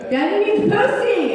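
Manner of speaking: put-on high, loud kid yelling the demand; frustrated child yell. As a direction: shout it like a furious little boy. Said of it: "he was getting mad".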